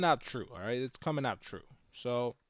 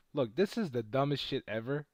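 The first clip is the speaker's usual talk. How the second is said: ordinary talk, nothing marked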